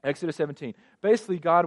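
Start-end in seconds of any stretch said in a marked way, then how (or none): none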